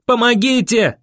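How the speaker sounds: high desperate cry; yelling for help. A narrator's reading. Boy yells on the run for help, yelling. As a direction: call out across empty streets. Yelling through empty town.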